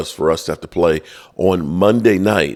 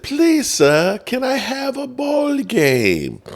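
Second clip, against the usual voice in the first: much higher, put-on pleading voice; hammed-up meek beggar; begging; slower than usual. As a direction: plead in a high mock orphan-like whine, drawing out the end. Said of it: "even though you begged and said".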